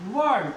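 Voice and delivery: वर्ड said with no r sound; वर्ड, the r left silent; word without r. Shown as a demonstration. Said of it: "आर का उच्चारण नहीं करना है". (वर्ड बिना र के)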